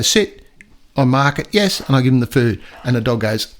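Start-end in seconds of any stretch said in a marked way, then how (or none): 0.0-0.4 dog command. Shown as a demonstration. Trainer demonstrates giving the dog a high, firm sit cue. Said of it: "I go".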